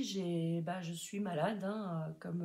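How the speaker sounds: normal talking voice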